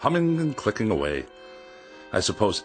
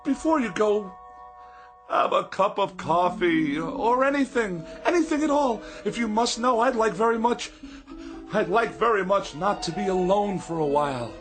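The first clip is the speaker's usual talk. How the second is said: desperate pleading; 12 semitones higher than usual. Acted. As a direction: beg her to stay, voice pitched up. Begging secretary not to leave.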